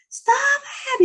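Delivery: high sharp mock scream of stop; yelling at a boy to stop, high and sharp; retold scream. Retelling a kid's fight, a high mock scream. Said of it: "he's screaming".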